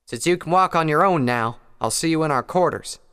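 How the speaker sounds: called across the distance; calling out. A narrator's reading. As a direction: call out to her. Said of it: "she called out".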